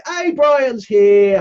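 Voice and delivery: slow, loud welcome call to a friend; calling out a greeting. Real, not acted. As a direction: announce the new arrival brightly. Greeting a viewer who joins.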